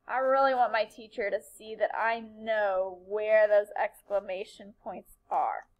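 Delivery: ordinary talk, nothing marked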